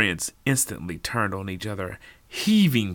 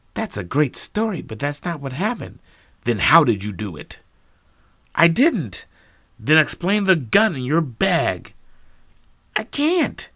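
heated argument; 7 semitones higher, 6 dB louder than usual. Acted. Heated accusation exchange.

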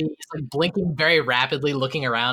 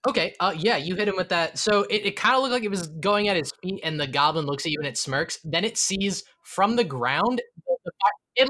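usual speaking voice

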